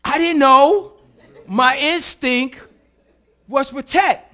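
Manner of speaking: high, loud and slow; heated protest. Real, not acted. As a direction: insist on your instinct. Defending herself, heated.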